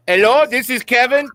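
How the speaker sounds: high, bright-put-on call-centre greeting; hammed-up spam caller voice; mock scam caller. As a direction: fake a friendly cold-caller hello. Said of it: "you're getting Kevin".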